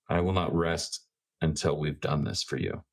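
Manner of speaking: ordinary talk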